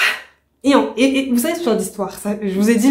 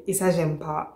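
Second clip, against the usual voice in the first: muttered displeasure; 5 semitones lower, 9 dB quieter than usual. Real, not acted. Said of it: "je suis énervée".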